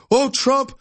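high sneering taunt; mock the reporters in a high voice; high mocking voice taunting Trump; mocking media voice. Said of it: "they're saying".

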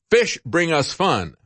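usual speaking voice

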